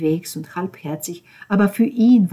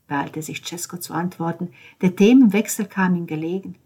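ordinary delivery, nothing marked

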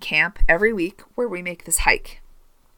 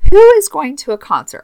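high, loud incredulous question; 9 semitones higher, 10 dB louder than usual. Real, not acted. Podcaster questioning the product's concert use, loudly incredulous. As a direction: come in hard on the first word. Scoffing at suggested uses of product.

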